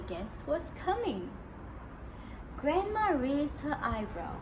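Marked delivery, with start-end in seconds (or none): none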